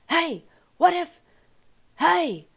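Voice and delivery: high, loud, goofy sportscaster bellow; hammed-up imitation of a drunk old baseball announcer; Will Ferrell as Harry Caray. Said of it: "Will Ferrell as Harry Carey on S N L doing the".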